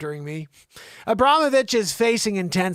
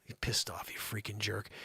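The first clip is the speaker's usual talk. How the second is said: hushed mocking insult; whisper; 10 semitones lower, 13 dB quieter than usual. Real, not acted. Host whispers an insult at the sulky oligarch. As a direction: whisper the jab under your breath.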